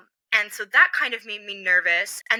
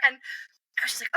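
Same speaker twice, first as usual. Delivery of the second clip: normal talking voice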